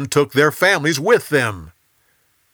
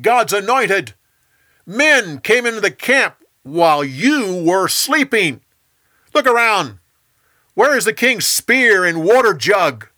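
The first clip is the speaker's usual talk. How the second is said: raised, accusing call, higher and louder; shouting across; 8 semitones higher, 5 dB louder than usual. A narrator's reading. David rebukes Abner for failing to guard the king, shouting across.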